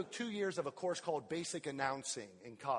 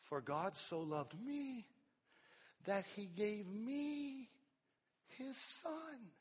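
tender personal reading; 5 semitones higher, 5 dB quieter, much slower than usual. Shown as a demonstration. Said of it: "see it this way".

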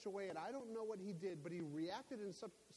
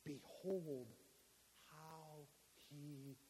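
tender reading; 4 semitones lower, 6 dB quieter, much slower than usual. Acted.

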